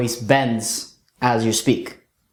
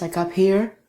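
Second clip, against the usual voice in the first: higher and softer, thin; 7 semitones higher than usual. Shown as a demonstration. Coach shows a big guy's soft voice. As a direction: lift it up and soften it. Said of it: "you have a very soft voice".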